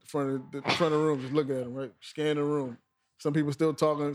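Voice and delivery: ordinary delivery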